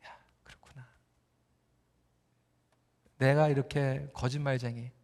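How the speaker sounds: very soft, low murmur to oneself; acted dawning self-reproach, nearly whispered; hushed realisation. Jacob realises he himself is the liar, hushed realisation.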